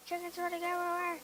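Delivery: loud, fast excited shout. Real, not acted. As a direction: blurt it out thrilled. Excited at fleeing chickens.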